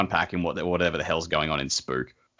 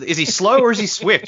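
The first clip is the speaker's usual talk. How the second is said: high incredulous question; baffled, pitched-up question; 12 semitones higher, 5 dB louder than usual. Real, not acted.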